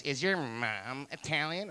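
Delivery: slow, lightly put-on questioning voice; lightly imitated woman. Woman quizzes stranger about his family, lightly imitated. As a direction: draw the question out, a hint of another voice.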